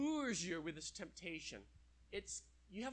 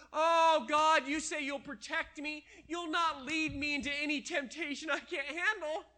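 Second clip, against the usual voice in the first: high, loud pleading self-justifying voice; hammed-up whiny excuse to God; excuse-making drinker; 9 semitones higher, 9 dB louder than usual. Preacher acts out an alcoholic justifying the bar, loud high whine.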